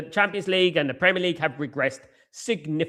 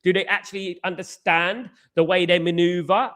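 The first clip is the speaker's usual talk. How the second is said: usual speaking voice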